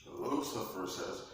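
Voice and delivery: normal talking voice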